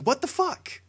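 high-pitched, stunned reaction; incredulous. Retold or imitated. Teller recalling seeing Obama walk in, incredulous. Teller voices disbelieving thought.

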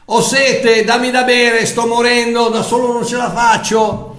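high, loud, pleading cry of a dying man; a loud, high plea for help, voice strained with need; desperate cry for help. Acted. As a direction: beg for rescue as if dying of thirst. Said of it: "il tuo cuore che dice".

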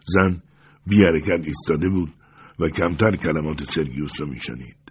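plain reading voice

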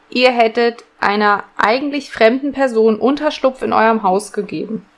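usual speaking voice

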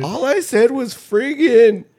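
put-on high, slow kid protest; put-on high-pitched child gamer. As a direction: play a kid pleading innocence in a high voice. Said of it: "that's literally the fucking kid".

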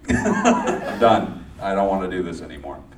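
loud, laughing through a dramatic resignation; laughing mock quit. Mock quitting while giggling.

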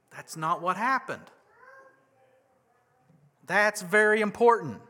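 ordinary talk

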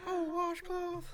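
very high squeaky cartoon voice; squeaky impression. Friend imitating a squeaky cartoon character, very high voice. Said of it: "he has that raspy voice".

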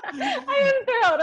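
high, loud, drawn-out mock excitement, laughing; hammed-up thrill. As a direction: overdo your delight for the teasing. Teased for sounding unenthused, overdoes enthusiasm.

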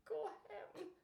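high, very soft voice; small stunned reply. Retold or imitated. Woman answering the man who screamed at her, faint high voice. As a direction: squeak it out faintly.

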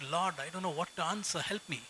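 hushed prayer. Retold or imitated. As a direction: pray under your breath to the Lord. Retold whispered prayer.

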